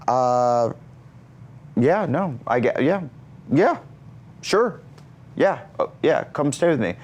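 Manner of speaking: high, halting reenacted yes; reluctant agreement. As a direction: stall between words, then give in. Caught off guard, agrees reluctantly.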